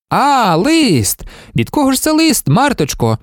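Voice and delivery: high, sing-song nosy teasing. A narrator's reading. Bookkeeper spotting Marta's letter, teasing and nosy. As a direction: pounce on it playfully, pitch swooping up.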